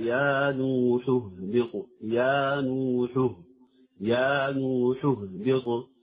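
slow يا نوح اهبط, ح and ه kept distinct; measured tajweed model of يا نوح اهبط; careful recitation. Shown as a demonstration. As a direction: stretch the vowels of يا نوح اهبط, sounding both breathy consonants. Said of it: "يجب الانتباه إليها لأهميتها".

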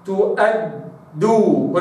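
تؤدوا slow, doubled dal after the fatha; tajweed recitation. Shown as a demonstration. Teacher models تؤدوا slowly. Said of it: "هكذا".

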